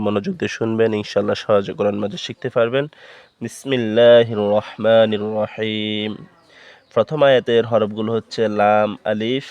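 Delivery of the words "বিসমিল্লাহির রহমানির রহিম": slow, drawn-out Arabic recitation; measured sacred recitation; Quran recitation. Shown as a demonstration. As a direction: recite the Bismillah slowly, stretching the long vowels.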